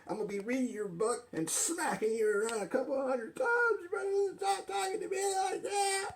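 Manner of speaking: rising threats, ending in a shout; threaten, then yell the last line; arguing with myself. Acted. Argument escalates to threats.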